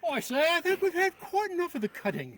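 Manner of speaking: English officer grumbling at the interruption; English accent. Acted. Skit officer protesting the cut: English accent. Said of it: "break into an English accent".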